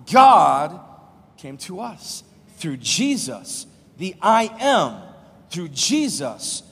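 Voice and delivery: preacher hammering the point home; preaching climax. Acted. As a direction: thunder God, then slow the rest down.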